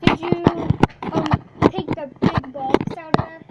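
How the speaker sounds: loud call across the house. Real, not acted. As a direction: call out to someone in another room. Calling to someone in another room.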